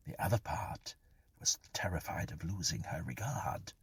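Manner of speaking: ordinary delivery, nothing marked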